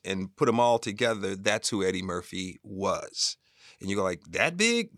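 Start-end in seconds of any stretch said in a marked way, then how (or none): 4.3-4.9 mock disbelief, higher and louder, rising at the end; incredulous question. Listener reacting to how famous Eddie was, mock disbelief. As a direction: act out an amazed listener.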